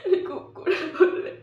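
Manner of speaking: stronger, tearful outburst of guilt; anguished confession. Acted. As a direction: force the confession out through tears. Same confession repeated with more force.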